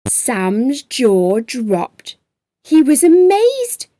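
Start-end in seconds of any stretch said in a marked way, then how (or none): 2.6-3.8 high, loud gasp of wonder on amazed. A narrator's reading. Narrator telling children Sam was amazed, loud astonished wonder. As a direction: sound truly astonished.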